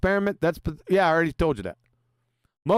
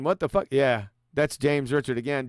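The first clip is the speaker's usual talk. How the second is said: usual speaking voice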